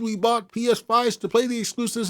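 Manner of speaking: high-pitched mocking fan voice; mocking whiny fan. Quoting the fan's complaint in a high, mocking voice. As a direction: parody the fan whining about exclusives.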